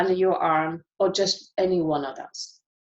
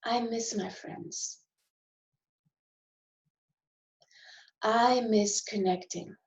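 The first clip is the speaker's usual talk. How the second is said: demonstrated sadness. Shown as a demonstration. Tapping coach moving into sadness, slow and hushed. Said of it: "Okay, we're going to move into sadness".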